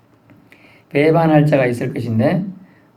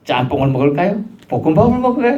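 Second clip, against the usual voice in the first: higher, laughing mock dithering over a menu; mock pondering; 5 semitones higher than usual. Preacher mimicking someone choosing lunch, laughing and higher. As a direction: play a man torn between dishes, chuckling. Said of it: "고민하는 것처럼".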